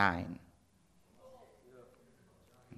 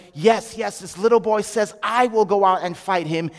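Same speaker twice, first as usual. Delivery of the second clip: loud, raised, excited voice; excited telling; 5 semitones higher, 5 dB louder than usual. Acted. Father telling mother the news, excited. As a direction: relay the big news at full energy.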